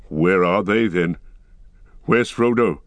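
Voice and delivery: the dwarf calling out, worried and pressing; urgent cry. Acted. Gimli demanding of Aragorn where Frodo is, loud and urgent. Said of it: "cried Gimli".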